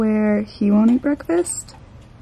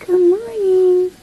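high drawn-out greeting; 9 semitones higher, 5 dB louder, slower than usual. Real, not acted.

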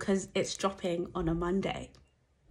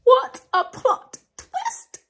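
high, slow, loud gasp of disbelief; real shock at the twist; stunned exclamation; 14 semitones higher, 6 dB louder, much slower than usual. Real, not acted. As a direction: drag it out in shock. Shocked reaction to trailer twist.

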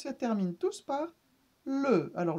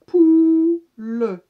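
"poule" called out loud and higher, final E sounded as "pulə"; loud drawn-out word; 7 semitones higher, 13 dB louder than usual. Shown as a demonstration. Teacher calling out "poule" as the class sounds it out. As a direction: hold out "poule" loudly for pupils. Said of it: "il manque la première syllabe".

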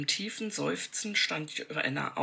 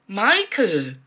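loud, high shocked call of his name; horrified cry; 5 semitones higher, 10 dB louder than usual. A narrator's reading. Joanna catches Michael attacking the maid, shouting in horror. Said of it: "rief Joanna entsetzt".

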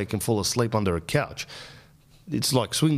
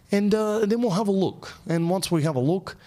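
higher, lightly voiced doctor; mock doctor voice; 9 semitones higher than usual. Doctor explains the nose spray to patient, higher. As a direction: lift the pitch for the doctor again.

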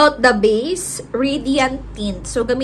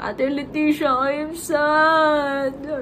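slow, mopey imitation of a sad fan; mock sad; 4 semitones higher, much slower than usual. Quoting her sad followers, slow mock moping. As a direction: drag out I am sad like a sulking follower. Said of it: "ang daming nagiging sad".

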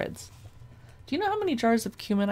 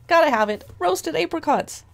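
loud, excited mock craving; eager outburst; 7 semitones higher, 7 dB louder than usual. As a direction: burst out loudly, playing the instantly sold shopper. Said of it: "and I'm like".

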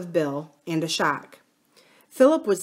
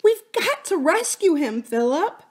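put-on high, pleading child voice; a frightened girl asking her brother for help; worried little girl; 10 semitones higher than usual. A narrator's reading. Lucy Ann voiced in story.

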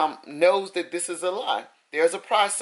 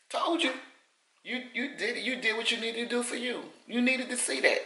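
gentle told you; 4 semitones higher, 6 dB quieter than usual. Pretend. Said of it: "That's my way of saying".

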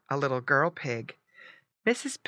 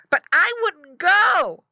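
high, loud, drawn-out piglet; slow, squeaky emphatic piglet; boy piglet; 10 dB louder, slower than usual. Acted. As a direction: declare it as a young pig. Boy pig voiced in story.

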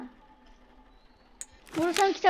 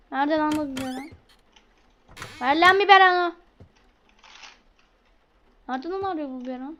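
normal talking voice

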